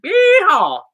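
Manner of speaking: loud, high Southern cowboy whoop; big mock Southern cheer; playful Southern yeehaw. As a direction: holler it like a Southern rodeo cowboy.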